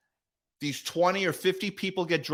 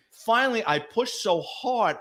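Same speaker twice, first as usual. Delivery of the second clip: usual speaking voice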